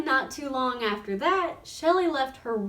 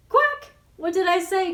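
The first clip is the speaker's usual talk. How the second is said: a high, loud gloating quack; cried; 6 semitones higher, 6 dB louder than usual. A narrator's reading. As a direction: crow it at her as she slides by. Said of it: "cried the duck".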